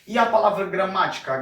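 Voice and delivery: usual speaking voice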